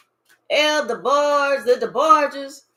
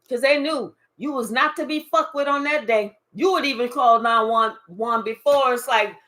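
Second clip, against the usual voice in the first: normal talking voice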